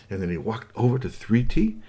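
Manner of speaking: usual speaking voice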